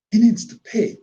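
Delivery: high, lightly surprised inner voice; sudden realization. Retold or imitated.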